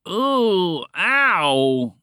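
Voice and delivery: long, loud moans of pain; pained moaning. A narrator's reading. Two friends after a crash landing, moaning. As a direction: moan it out, stretched and aching. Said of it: "they both moaned as everything settled".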